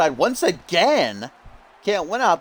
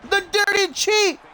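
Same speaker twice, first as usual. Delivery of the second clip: high, indignant yelling; outraged shout; 14 semitones higher than usual. Real, not acted. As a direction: call out the cheat, outraged. Heel cheats with food coloring.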